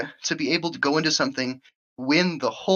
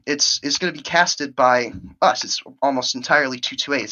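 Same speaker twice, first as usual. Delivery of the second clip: ordinary delivery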